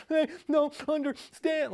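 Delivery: put-on sobbing kid. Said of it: "They're like".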